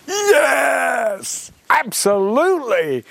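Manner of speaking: loud high cry of triumph; triumphant shout. Real, not acted. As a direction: shout it out in victory. Triumphant exclamation, title accepted.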